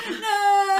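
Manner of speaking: high, loud wail with laughter; mock horror. Viewer hearing the creepy kid on the phone, mock horror. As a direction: cry out in hammed-up dread. Retold refusal in fright.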